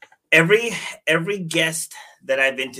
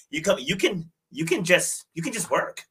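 voicing a Thai woman; 9 semitones higher than usual.

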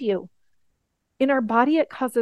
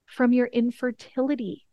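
normal talking voice

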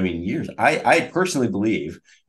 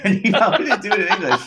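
laughing through the words, high and fast; 11 semitones higher, 6 dB louder, much faster than usual. Real, not acted. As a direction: giggle it out as the joke lands. Joking about Napoleon's language.

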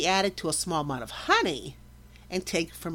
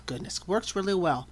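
ordinary talk, nothing marked

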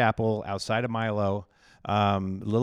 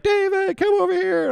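much higher mock old lady calling him over; imitating Norma calling; 5 dB louder than usual. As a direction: squeak it up high like an eager church lady. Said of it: "So Norma's like".

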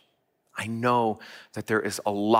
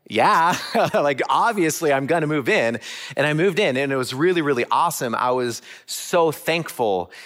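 excited, louder and higher, with a laugh; laughing relief; 7 semitones higher, 6 dB louder than usual. Retold or imitated. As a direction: burst out with happy relief. Joyful relief at being taken in.

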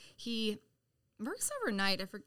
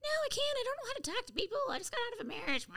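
much higher, squeaky mock panic; flustered retold self; 14 semitones higher, faster than usual. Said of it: "I'm like".

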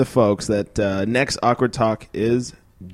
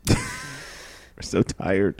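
laughing, slow and drawn out; 6 dB quieter, much slower than usual. Real, not acted. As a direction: laugh through the words, tired. Exhausted laughing at end.

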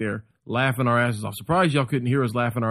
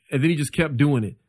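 usual speaking voice

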